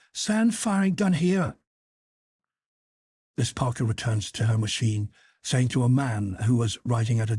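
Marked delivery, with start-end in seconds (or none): 0.1-1.6 high, piercing North of Ireland call; drawn-out, high Northern Irish summons; furious North of Ireland accent. A narrator's reading. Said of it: "a furious voice called out in a piercing North of Ireland accent".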